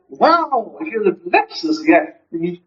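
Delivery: high-pitched amazed wow; excited. Acted. Friend hears about the Lexus, excited. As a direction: act out an impressed friend. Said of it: "how the mood changes".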